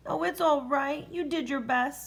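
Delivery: plain reading voice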